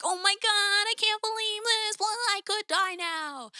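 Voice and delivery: mock a starstruck fan losing it, stretching the last words; squealing superfan. Imagining a super fan invited on stage, high mock squeal. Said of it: "as a super fan, I'm sure someone would be like".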